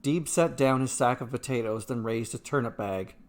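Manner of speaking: usual speaking voice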